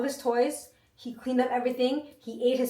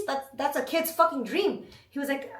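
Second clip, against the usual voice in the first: ordinary talk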